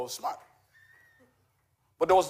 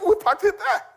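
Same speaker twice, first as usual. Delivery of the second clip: much higher, with a laugh in the voice; mock alarm at finding the car gone; high, laughing mock panic to his wife; startled question; 7 dB louder than usual. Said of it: "I'm like".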